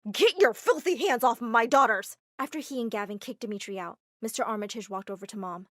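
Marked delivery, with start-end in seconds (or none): 0.1-2.2 furious father. Acted. Father throws out Dimitri.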